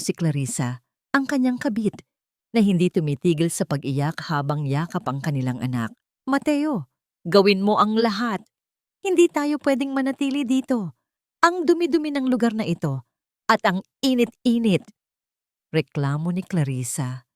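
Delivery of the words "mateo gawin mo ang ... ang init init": higher, whining mistress voice; a spoiled mistress fussing over the dirt and heat; lightly voiced complaining; whiny complaint. A narrator's reading. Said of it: "puno ng pag-iinarte".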